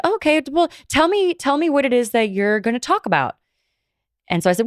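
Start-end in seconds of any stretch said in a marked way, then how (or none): 0.1-3.4 imitating mom, pitched much higher; mother's voice. Daughter imitates her mother asking what she'll discuss, high mom voice. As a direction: lightly voice mom, high and pushy.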